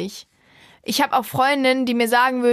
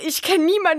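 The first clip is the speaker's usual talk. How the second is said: ordinary talk, nothing marked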